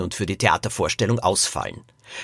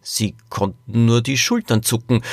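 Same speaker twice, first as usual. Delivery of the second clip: ordinary delivery, nothing marked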